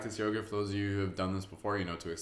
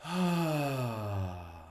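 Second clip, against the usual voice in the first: long audible sigh out; releasing sigh; demo sigh; 2 semitones higher than usual. Shown as a demonstration. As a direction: let the breath go with a sigh. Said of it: "Give yourself a nice sigh".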